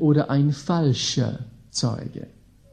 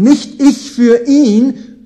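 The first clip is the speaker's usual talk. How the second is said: slow, raised and loud on "Nicht"; emphatic contrast; 5 semitones higher, 7 dB louder, slower than usual. Real, not acted. Preacher insisting to the congregation, slow loud emphasis. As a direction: hammer the "Nicht" home, slowly. Contrast of self versus Christ.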